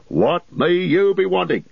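high, bustling landlord voice; a cheery host bending down to greet; jolly innkeeper. A narrator's reading. Landlord asks.

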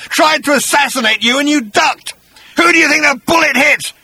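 high, loud accusing shout; ranting Agrajag. Acted. Agrajag asking who took the bullet, accusing shout. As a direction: hurl the accusation at full pitch.

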